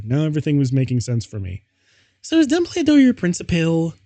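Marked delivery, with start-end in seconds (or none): 2.2-3.9 put-on squeaky high girly shout. A narrator's reading. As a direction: pitch way up and yell it like a giddy teen girl. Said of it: "I shouted".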